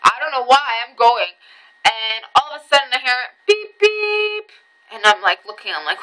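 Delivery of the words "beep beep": high, held honking beeps; imitated car horn. Car honking at her as she walks, high imitated honk. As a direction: honk it vocally, climbing higher.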